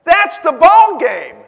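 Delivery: booming, slow preacher's call; loud slow sermon refrain; preaching at full force. Acted. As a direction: proclaim it to the whole room, drawn out.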